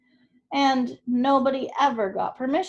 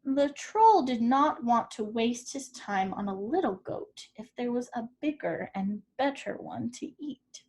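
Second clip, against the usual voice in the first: usual speaking voice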